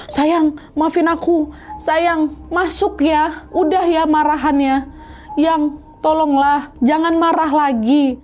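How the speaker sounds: high, slow begging voice; pleading wife. Acted. Pregnant wife begging husband to stop being angry, high and slow. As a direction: beg your husband to forgive you, drawn out.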